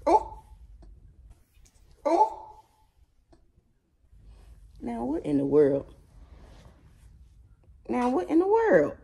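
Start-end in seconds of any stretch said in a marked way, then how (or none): 0.1-2.6 high, startled exclamation; a sudden gasp of surprise at the dog; surprised oh. Real, not acted. To the dog acting up, a high surprised oh. Owner reacts to dog's antics. 7.9-9.0 much higher, sing-song repeat; mock baby-talk question. Owner asking the dramatic dog, high mock disbelief.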